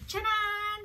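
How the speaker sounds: bright, high fanfare call; sing-song ta-da to the viewers; excited ta-da. Real, not acted. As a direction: announce it like a magic reveal. Opening the live stream with flourish.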